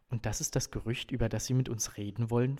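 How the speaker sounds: understated suspicion in a question; suspicious undertone. A narrator's reading. Father questions the coach about the rumour, mildly suspicious. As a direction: probe with a touch of mistrust. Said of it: "mit einem misstrauischen Unterton".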